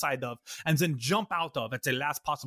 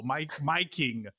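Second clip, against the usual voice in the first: ordinary talk, nothing marked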